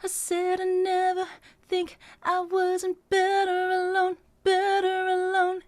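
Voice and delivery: slow sung line, accent on "better"; bouncy voice effect. Shown as a demonstration. Coach demonstrating the bouncy voice effect. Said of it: "I call this the bouncy voice effect".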